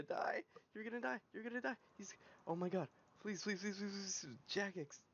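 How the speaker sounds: high, tense, urgent repetition; frantic pleading. Real, not acted. Gamer begging Jagex for the kill, frantic pleading. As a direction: beg in a high, rushed voice.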